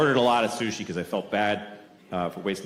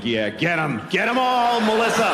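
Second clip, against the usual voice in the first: gleeful cheer; 11 semitones higher than usual. Pretend. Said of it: "I'm like".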